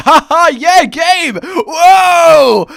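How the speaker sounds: loud hammy gamer whoop; hammed-up gamer. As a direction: overdo a laughing gamer. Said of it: "the quality level we can expect".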